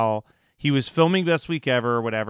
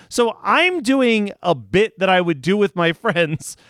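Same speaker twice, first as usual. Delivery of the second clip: high, loud words through laughter; laughing embarrassed; 8 semitones higher, 4 dB louder than usual. Real, not acted. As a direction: laugh at your own blunder as you say it.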